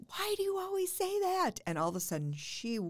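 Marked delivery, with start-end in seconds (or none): 0.1-1.5 put-on much higher, louder, annoyed teen pushback; exasperated daughter. Daughter to mom, annoyed at her question, high exasperated whine. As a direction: snap back like an irritated daughter. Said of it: "And she goes".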